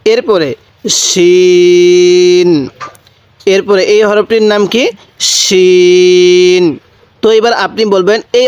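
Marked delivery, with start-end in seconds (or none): 0.9-2.7 শিন held very long with a full thick sh; thick shin. Shown as a demonstration. Teacher models Arabic shin, thick and very slow. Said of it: "এই শিনের আওয়াজটা একটু মোটা করে করব". 5.2-6.6 শিন held very long with a full thick sh; thick shin. Shown as a demonstration. Teacher models Arabic shin, thick and very slow. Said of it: "এই শিনের আওয়াজটা একটু মোটা করে করব".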